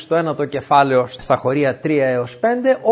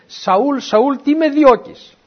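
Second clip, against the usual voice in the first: higher, louder, slow call from above; heavenly calling voice; 10 semitones higher, 5 dB louder, much slower than usual. Acted. The Lord calling Saul on the road, slow and raised. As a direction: call him down from the sky, drawn out.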